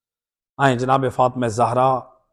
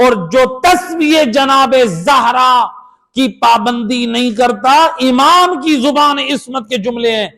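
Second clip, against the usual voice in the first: fervent preaching; 8 semitones higher, 4 dB louder than usual. Real, not acted. Preaching at full force.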